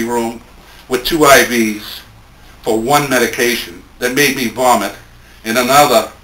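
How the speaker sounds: measured, lightly lifted poetry reading; poem reading. Acted. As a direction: read it gently, letting each image land. Reads Michael's poem aloud.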